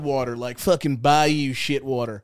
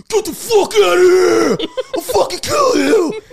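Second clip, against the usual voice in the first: hammed-up furious scream, high, loud and drawn out; Jasper; 11 dB louder than usual. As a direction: imitate a friend screaming at raccoons, voice cracking upward. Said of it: "Jasper's weird-ass voice, like, his, like, deep voice".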